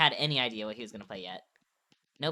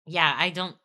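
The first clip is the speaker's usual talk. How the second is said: usual speaking voice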